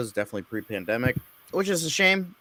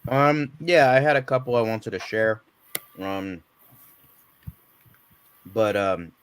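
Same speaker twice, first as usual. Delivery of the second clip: chewing while talking. Real, not acted. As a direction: mouth full of candy. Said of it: "sorry, I'm eating M and M's right now".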